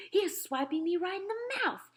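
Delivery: high, loud yell rising in pitch; outraged yell. A narrator's reading. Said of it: "yelled the meat dripper".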